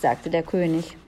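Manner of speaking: ordinary delivery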